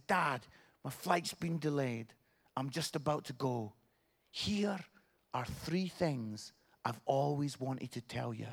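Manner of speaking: soft, low, with pauses; tender reading. Retold or imitated. Dad reads daughter's farewell text, softly tender. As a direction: read a heartfelt message gently.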